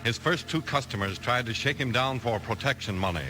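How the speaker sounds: normal talking voice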